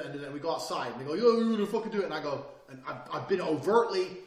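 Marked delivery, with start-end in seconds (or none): 1.1-2.1 loud, high mock challenge; raised voice, goading in imitation; retold challenge. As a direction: imitate a rowdy guy squaring up. Rivals answering in a retold fight.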